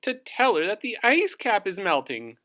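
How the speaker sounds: a small bird explaining the errand; high chirpy voice; Dovekie Lovekie's voice. Acted. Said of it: "said Dovekie Lovekie".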